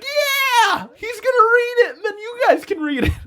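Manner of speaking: very high, loud hammed-up glee; imitating a wildly eager man yelling; over-excited. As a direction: squeal it with oblivious enthusiasm. Oblivious Gary cheering along, retold.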